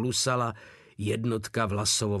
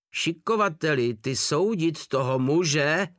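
higher, indignant sergeant's demand; angry cry; 5 semitones higher, 4 dB louder than usual. A narrator's reading. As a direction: call out angrily, drawing the words out. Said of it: "vykřikl wachtmeister vztekle".

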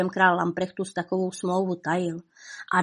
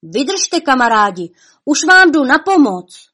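very loud, high cry of encouragement; rallying shout; 6 semitones higher, 9 dB louder than usual. A narrator's reading. Valihrach calls to his struggling comrades, rallying shout. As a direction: shout to friends that rescue is coming. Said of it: "uslyšeli výkřik Valihrachův".

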